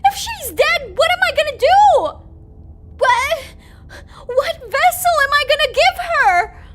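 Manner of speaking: high, loud panicked cries; panicked yelling. Real, not acted. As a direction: shout in rising panic. Panics about the vessel.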